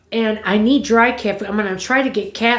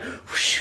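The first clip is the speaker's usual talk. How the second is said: whispered whoosh sound; 9 dB quieter than usual. Retold or imitated. Birds flying off the porch.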